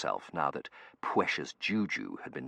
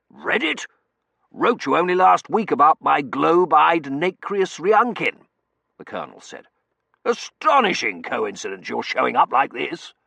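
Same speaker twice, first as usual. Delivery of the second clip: gruff colonel voice; 10 semitones higher, 12 dB louder than usual. Acted. Colonel character voice.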